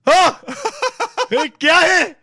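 high startled laughing reply; hammed-up Hindi reply; mock startled reply. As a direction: play the caught son, laughing. Friend voiced answering mom.

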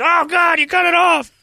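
high shrieking gasp of fake horror; a hammed-up high scream over the severed ear; mock shriek. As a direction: shriek it like he's seen blood. Retold shocked bar friend.

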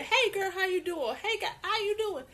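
bright, high friendly hello; high, chirpy hey; friendly greeting. Retold or imitated. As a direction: call out cheerfully to a stranger. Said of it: "I was the person that went over there".

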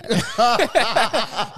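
loud, high belly laugh; big high-pitched cackling laugh; real burst of laughter. Real, not acted. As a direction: laugh hard at your own story. Laughs at own childhood story.

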